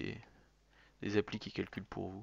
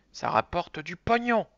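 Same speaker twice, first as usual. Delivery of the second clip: higher, louder and slower, selling it big; emphatic hype; 9 semitones higher, 11 dB louder than usual. Acted. Streamer hypes viewers that beer brings in money, emphatic. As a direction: stretch it out like a grand pitch.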